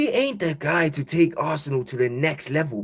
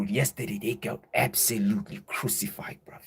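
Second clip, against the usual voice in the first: whispered gloating aside; 4 semitones lower, 7 dB quieter than usual. Real, not acted. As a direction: whisper it with glee.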